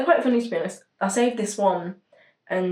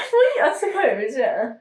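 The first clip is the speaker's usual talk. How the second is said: laughing; 8 semitones higher than usual. Real, not acted. Laughing concession.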